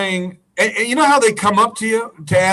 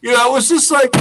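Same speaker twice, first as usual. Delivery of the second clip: normal talking voice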